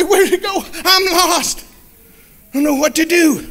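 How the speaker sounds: a small lamb lost and scared; lost lamb crying out alone; frightened lamb. Acted.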